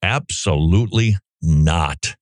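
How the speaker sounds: ordinary delivery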